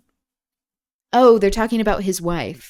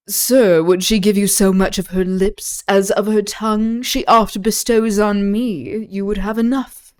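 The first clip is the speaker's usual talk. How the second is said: louder, lightly voiced sneering Iago; a touch of Iago's mocking edge; 3 semitones higher, 6 dB louder than usual. Iago teasing Cassio about his wife, in a louder Iago voice. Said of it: "That's my Iago voice".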